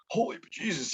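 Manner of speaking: high, startled "Holy Jesus"; surprised exclamation. Retold or imitated. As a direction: blurt it out in surprise. Reacting to chef's scolding.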